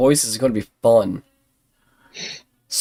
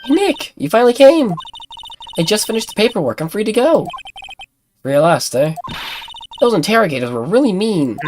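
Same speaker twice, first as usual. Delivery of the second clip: higher-pitched, chatty game character; excitable freed friend; 8 semitones higher, faster than usual. Acted. Freed friend tells Nick about the interrogators, high-pitched and animated. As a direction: play a chirpy friend venting freely.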